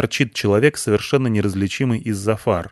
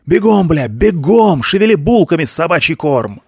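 commanding yell; 7 semitones higher, 5 dB louder than usual. A narrator's reading. Commander yelling at narrator.

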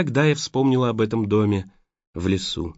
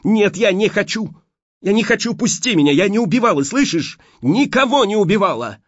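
desperate cry; 10 semitones higher, 4 dB louder than usual. A narrator's reading. Heroine screams denial at Steve.

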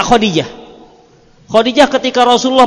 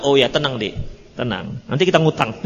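calm husband; 8 semitones lower, 7 dB quieter than usual. Retold or imitated. Husband calmly replying.

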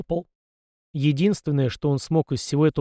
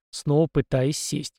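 usual speaking voice

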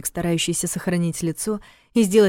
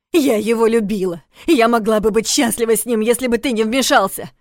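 loud, high tearful yell; angry shout; 4 semitones higher, 6 dB louder than usual. Acted. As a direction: shout it through tears. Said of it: "взорвалась Настя".

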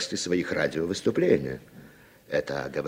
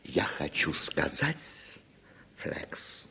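breathy hushed whisper; 2 semitones lower, 8 dB quieter, much slower than usual. Acted. Frank leaning in to his sponsor, whispering. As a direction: drop to a whisper for the name.

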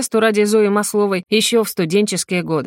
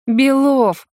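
high, louder reproach; displeased; 7 semitones higher, 4 dB louder than usual. A narrator's reading. Melnikova rebukes Belov, displeased. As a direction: call him out sharply. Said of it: "недовольным взглядом".

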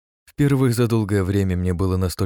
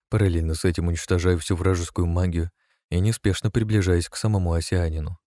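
usual speaking voice

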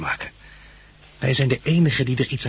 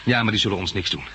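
ordinary talk

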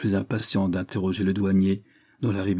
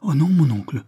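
tearful; 7 semitones higher, 5 dB louder than usual. A narrator's reading. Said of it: "fit-elle en pleurant".

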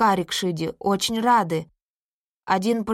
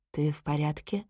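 soft, lower, tender question; gentle; 5 semitones lower, 7 dB quieter than usual. Acted. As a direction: check on her gently. Марк утешает плачущую Сью. (Марк мягко и тихо спрашивает плачущую Сью)

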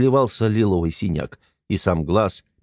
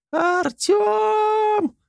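a piercing, much higher yell of a name; girl screaming for Artyom; shrieking his name. A narrator's reading. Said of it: "заорала Ниела".

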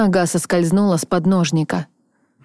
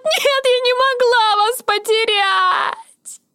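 медленный крик сквозь рыдания; кричи и рыдай, очень высоко; toddler tantrum; slower than usual. Acted. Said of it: "Истерика охватила меня". (крик сквозь рыдания)

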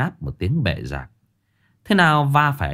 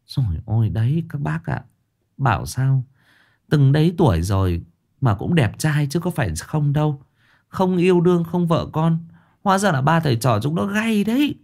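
higher gossipy woman voice; gossiping woman; 6 semitones higher than usual. Acted. Passing women gossiping about the three, gossipy. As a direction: gossip to the other ladies.

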